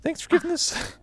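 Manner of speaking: put-on very high cutesy anime cat girl; squeaky kitten voice thanking fans. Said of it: "I have to do the cat girl voice".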